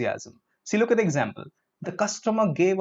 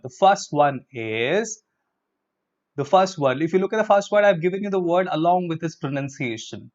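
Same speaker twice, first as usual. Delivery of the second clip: ordinary delivery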